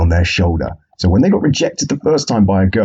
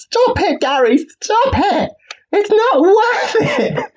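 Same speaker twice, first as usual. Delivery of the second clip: very high pleading shriek, last line slow; mock ex-girlfriend; slower than usual. Referee pleading with boxer Gary like an ex-girlfriend, shrill and high. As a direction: beg him to stop, hammed-up. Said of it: "like having an ex-girlfriend just fighting over two guys".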